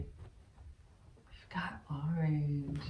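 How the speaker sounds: slow soft whisper. Acted. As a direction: breathe it out secretly. Whispered color announcement.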